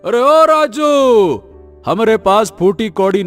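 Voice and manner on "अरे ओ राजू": high, drawn-out call of outrage; stretched, raised-pitch angry holler; angry shout. Acted. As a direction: yell the name in indignation. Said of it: "गुस्से से भर जाता है और चिल्ला उठता".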